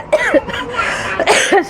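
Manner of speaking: sudden sharp cough mid-sentence; coughing fit. Real, not acted. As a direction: cough hard, then carry on. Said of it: "sorry, একটু কাশি".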